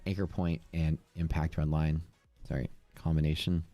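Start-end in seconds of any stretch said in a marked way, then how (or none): none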